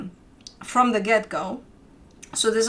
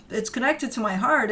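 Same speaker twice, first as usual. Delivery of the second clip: ordinary delivery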